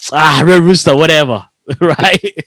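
usual speaking voice

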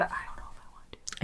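anxious hushed whisper. Real, not acted. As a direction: drop to a worried whisper. Confessing anxiety about returning.

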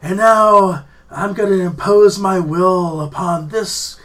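loud, slow, high proclamation; drawn-out, mock-solemn booming declaration; preacher on a mic. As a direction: imitate a man grandly announcing to a congregation. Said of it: "a mic and was like".

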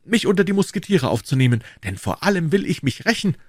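put-on high, urgent young avenger; hot-headed young D'Artagnan, pleading. D'Artagnan demands the man's name. (hitziger junger Held, hoch und drängend)

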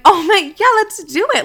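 high-pitched, thrilled yes; retold giddy enthusiasm; eager agreement. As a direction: jump on the plan, voice shooting up.

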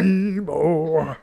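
demanding monster plant. Retold or imitated. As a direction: call out like a hungry plant. Plant calls Seymour.

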